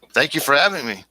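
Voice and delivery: normal talking voice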